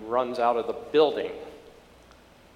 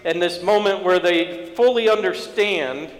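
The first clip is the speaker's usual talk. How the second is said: high, insistent sermon voice; impassioned preaching; 6 semitones higher, 6 dB louder than usual. Acted. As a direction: press the point home to the congregation. Preaching the point.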